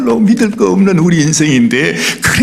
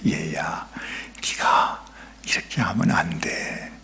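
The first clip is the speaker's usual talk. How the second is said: put-on softer whisper, like a parent warning a child; parent's hushed warning; 12 semitones lower, 13 dB quieter, slower than usual. A preacher voicing a parent who whispers a warning to a child.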